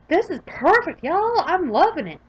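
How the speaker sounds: excited cheer. Real, not acted. Celebrating a perfect run.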